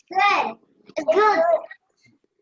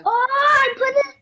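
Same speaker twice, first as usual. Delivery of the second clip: excited cry; 7 semitones higher, 6 dB louder than usual. Real, not acted. Child exclaims while cooking.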